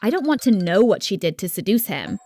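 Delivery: plain reading voice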